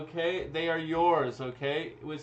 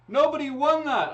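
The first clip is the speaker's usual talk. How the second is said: high, loud excited burst; excited exclamation; 11 semitones higher, 9 dB louder than usual. Real, not acted. Host blurting out the draw result, high and loud.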